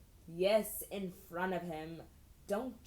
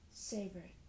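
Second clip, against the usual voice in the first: hushed, softer aside; whispered in his ear; 7 dB quieter than usual. A narrator's reading. Girl teasing a boy in his ear, hushed. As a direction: murmur it close to his ear. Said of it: "whisper in his ear".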